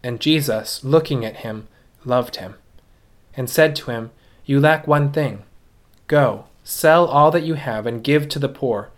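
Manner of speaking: normal talking voice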